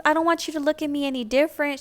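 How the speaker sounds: a hint of a gentle saleslady hurrying to reassure; reassuring lady. Vendor lady reassuring the speaker, light quick imitation.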